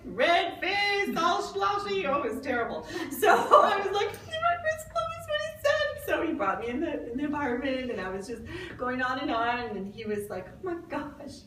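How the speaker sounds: high, loud words through real laughter; giggling helplessly at yourself; laughing hard. Real, not acted. Laughing while retelling.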